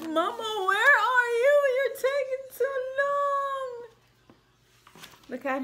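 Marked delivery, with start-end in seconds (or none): 0.0-3.9 put-on high crying baby voice; whiny baby llama. Mother reading to child, baby calling for mama. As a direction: whimper it like a lonely baby.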